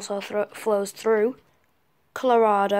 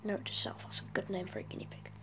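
fast whispered aside; quick hushed note to self; whispering; 3 semitones lower, 13 dB quieter, much faster than usual. Real, not acted.

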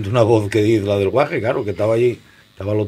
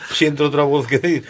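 ordinary delivery

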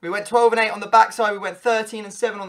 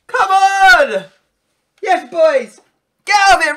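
yelling the scorer's name, much higher and louder; big goal celebration shout; excited shout; 9 semitones higher, 11 dB louder, slower than usual. Real, not acted. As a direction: roar his name at the goal.

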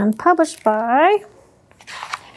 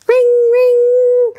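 high, loud doorbell ring; 9 semitones higher, 5 dB louder than usual. Acted. Doorbell rings.

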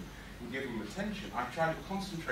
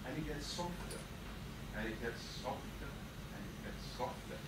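hushed, sinking lower with each repeat; fading softer; 3 semitones lower, 8 dB quieter than usual. Acted. Boy's rage calming with each heartbeat, ever softer. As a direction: let the voice die away gradually.

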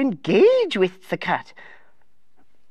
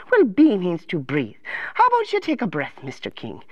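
lilting, gently concerned advisor voice; sing-song storybook helper; fussing counselor; 6 semitones lower than usual. Acted. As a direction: coo at a troubled little king.

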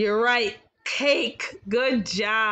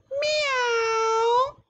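much higher mewing cat sound; cat meow; 13 semitones higher than usual. Retold or imitated. Teacher imitating a cat for the guessing game, high mew. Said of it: "an animal that says".

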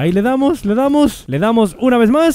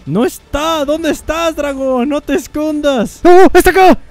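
excited calling; 8 semitones higher, 5 dB louder, slower than usual. Real, not acted. Hunting the hiding dragon.

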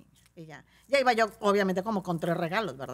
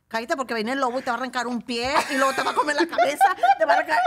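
louder, rising scary threat, laughing through it; mock threatening; 4 semitones higher, 9 dB louder, faster than usual. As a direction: scare the kid half-joking. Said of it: "oigo a Gaby que le dice". (amenaza del lobo, cada vez más fuerte y aguda)

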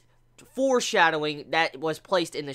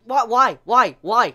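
louder, pleading why after why; exasperated loud whys; 4 semitones higher, 8 dB louder, slower than usual. Real, not acted. Reviewer begging Ishida to explain himself, loud exasperated pleading. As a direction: throw the whys at the author in disbelief.

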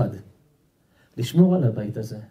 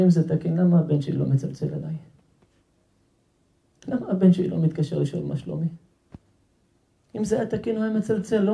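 put-on higher-pitched, plaintive old man; acted tearfulness; 4 semitones higher, 4 dB quieter than usual. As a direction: plead as if starting to cry, without sobbing. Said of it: "התחיל לבכות".

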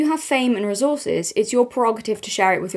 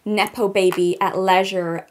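mock American accent as Kendall Jenner, valley-girl vibe; terrible American accent; slower than usual. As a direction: do a hammed-up American model voice. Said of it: "my terrible American accent".

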